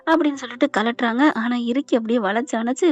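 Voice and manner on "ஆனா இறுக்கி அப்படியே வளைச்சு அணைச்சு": ordinary delivery, nothing marked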